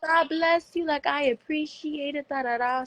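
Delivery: high, slow, grateful imitation; high, slow imitation of the maid thanking her; touched housekeeper. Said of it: "And she was like".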